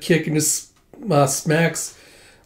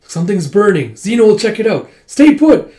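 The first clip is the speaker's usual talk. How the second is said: rising steadily to a high, loud command; urgent alert; 6 semitones higher, 7 dB louder than usual. Acted. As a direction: build in pitch and volume. Zeno alerts others to fire.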